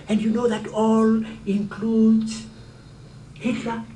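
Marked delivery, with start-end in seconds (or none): none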